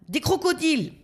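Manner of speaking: high warning yell. A narrator's reading. Blackbird warns his passengers, high yell. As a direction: shout a sudden warning. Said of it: "hurle Zigomar".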